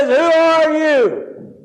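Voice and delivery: high, slow, stretched-out holler; calling up. Retold or imitated. Dangling man asks the unseen voice above, hollering. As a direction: shout the question up the cliff.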